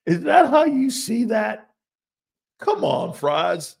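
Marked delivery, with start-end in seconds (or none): 0.1-1.7 high, loud mock disbelief; incredulous. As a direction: act stunned at the take. Mock incredulous question, repeated.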